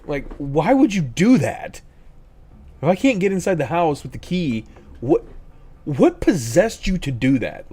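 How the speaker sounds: loud, high, slowed-down scolding; exasperated scolding. Retold or imitated. Parent scolding son who jammed the lock, loud exasperated scolding. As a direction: drag out each word in angry disbelief.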